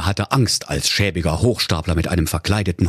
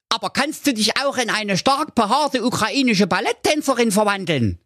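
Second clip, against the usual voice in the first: high, cheeky boot-wearing dog voice; cheeky dog character; 13 semitones higher than usual. A narrator's reading. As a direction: goad the wizard with a high voice. Dog keeps goading wizard.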